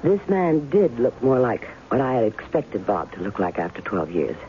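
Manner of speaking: calm, reflective first-person narration; story narration. A narrator's reading. Narrator recalling how the stranger shook her, calm. [name] narrates between scenes.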